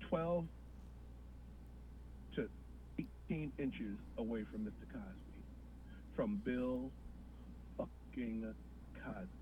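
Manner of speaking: very quiet, low awed voice; hushed awe. Real, not acted. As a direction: whisper it in disbelief. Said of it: "awed hush".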